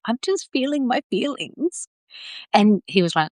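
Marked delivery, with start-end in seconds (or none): 0.1-1.9 lightly voiced, higher innocent answer; high mock reply. Telling husband in bed she's crying, high mock innocence. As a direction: chirp it up high, a touch tearful-cute. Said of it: "And I was like".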